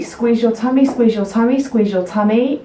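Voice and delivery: usual speaking voice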